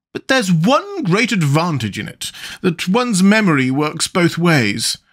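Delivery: high, slow queen's voice. A narrator's reading.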